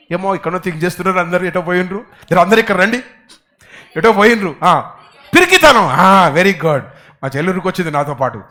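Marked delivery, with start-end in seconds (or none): none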